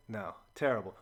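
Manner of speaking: normal talking voice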